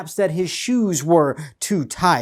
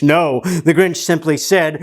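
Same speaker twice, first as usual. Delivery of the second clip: usual speaking voice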